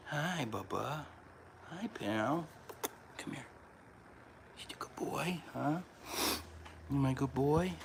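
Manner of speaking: high, soft cooing to the cat. Real, not acted. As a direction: coo tenderly at a beloved pet.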